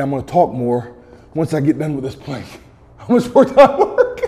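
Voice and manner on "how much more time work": high strained laughing question. Real, not acted. Laughing while holding a plank.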